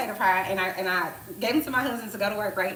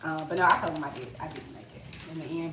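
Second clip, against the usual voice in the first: usual speaking voice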